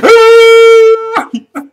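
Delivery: long, very high, loud mock scream; imitated terrified scream. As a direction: scream his brains out, hammed-up. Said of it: "he starts screaming".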